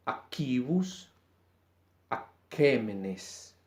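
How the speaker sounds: aquibus and aquémenes read slowly, with a K sound; slow Latin examples. Shown as a demonstration. Teacher reading example words, slow. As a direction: spell them out slowly with a hard K. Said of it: "su sonido es K".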